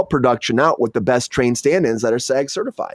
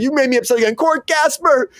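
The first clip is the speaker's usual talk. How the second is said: upset king; 12 semitones higher than usual. Retold or imitated. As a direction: wail for the gasper like a fussy king. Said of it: "He's like".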